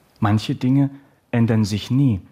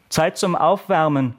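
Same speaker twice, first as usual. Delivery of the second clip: higher-pitched gym teacher's call; restrained bellow at a higher pitch; 7 semitones higher than usual. A narrator's reading. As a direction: call it out across the hall. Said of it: "brüllte der Sportlehrer durch die Halle".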